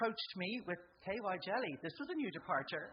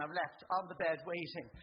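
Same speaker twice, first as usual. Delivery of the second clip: ordinary talk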